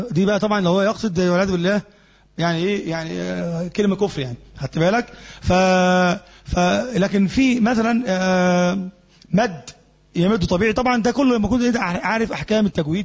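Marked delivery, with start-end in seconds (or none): none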